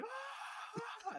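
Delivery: very high cartoon scream; Patrick scream. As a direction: shriek like losing a video game. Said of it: "fucking Patrick's like".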